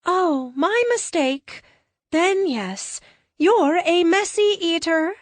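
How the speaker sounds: high, slow, soothing alien voice; sweet blonde alien consoling a friend; gentle alien comforting. Acted. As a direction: reassure him kindly, a bit otherworldly. Lux concedes, naive.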